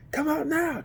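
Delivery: high squeaky call; mock calling out. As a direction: squeak it up at the house. Calling into the house.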